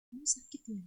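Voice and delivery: soft, tender caring question; gentle. Shown as a demonstration. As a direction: ask it softly and kindly. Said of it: "Lembut kan?". (bertanya dengan lembut)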